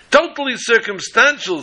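high-pitched, booming closing argument; grand courtroom orator. Acted. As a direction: play the showman attorney working the room. Attorney's quoted line.

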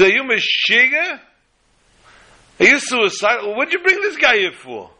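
mock him as crazy; incredulous scoffing. Businessman scoffing at skinny helper.

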